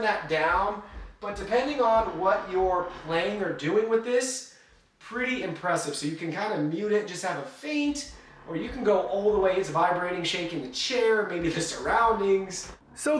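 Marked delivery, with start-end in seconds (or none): none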